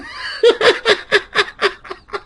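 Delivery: long, high cackle; high, pulsing belly laugh. Real, not acted. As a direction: laugh till the breath runs out. Laughs at the imagined request.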